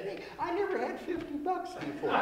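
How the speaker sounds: high, laughing dog voice; dog's punchline voice. Acted. Dog answering the owner, high and laughing. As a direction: squeak the line up high through a laugh.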